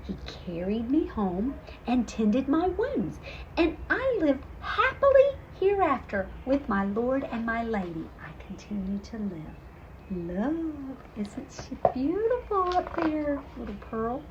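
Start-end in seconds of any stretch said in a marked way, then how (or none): none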